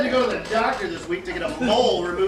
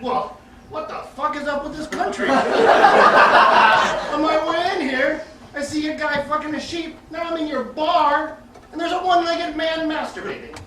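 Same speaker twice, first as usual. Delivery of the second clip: higher-pitched, indignant complaint; acted outrage; 6 semitones higher, 6 dB louder than usual. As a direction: rant in disbelief at the bartender.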